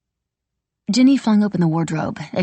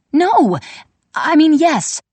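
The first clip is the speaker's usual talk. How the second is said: higher and louder, blurting then correcting; flustered outburst; 11 semitones higher, 6 dB louder, slower than usual. Acted. Sister panics, blurting a denial then correcting herself. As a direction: blurt it out, then catch yourself.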